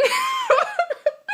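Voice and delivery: high laugh that tumbles down; real laugh. Real, not acted. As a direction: laugh helplessly at the memory. Laughing at the memory.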